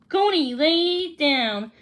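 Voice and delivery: ordinary delivery, nothing marked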